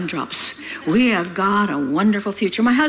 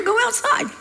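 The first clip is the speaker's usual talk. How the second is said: dad's quip; 12 semitones higher than usual. Retold or imitated. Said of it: "He said".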